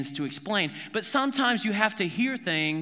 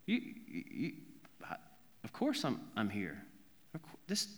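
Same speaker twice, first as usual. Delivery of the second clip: incredulous hush; 7 semitones lower, 12 dB quieter than usual. Acted. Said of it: "He just has this".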